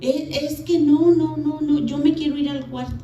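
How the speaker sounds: high, mock tearful refusal; imitated crying. Young wife begging to leave the room, imitated crying. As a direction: plead to get away, pitch up. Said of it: "Pues me puse a llorar".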